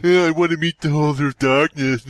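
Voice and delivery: laughing, goofy request voice; mock eager visitor. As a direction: play a dopey visitor asking at the desk. Mimics visitor asking for Holder.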